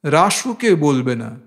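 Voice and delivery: usual speaking voice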